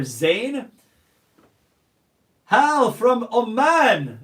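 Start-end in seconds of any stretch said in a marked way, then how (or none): 2.5-4.2 loud, slow, higher call; drawn-out greeting. Real, not acted. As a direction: stretch it out to greet them. Reading live chat shout-outs.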